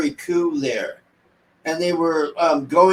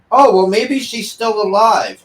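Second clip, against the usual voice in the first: higher, naive mock-hopeful voice; mocking the kid; 6 semitones higher, 4 dB louder than usual. Reviewer imitating the kid at the stairwell, mock naive. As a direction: play the clueless kid.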